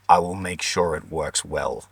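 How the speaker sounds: sly midwife. Retold or imitated. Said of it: "she smiled and she's like".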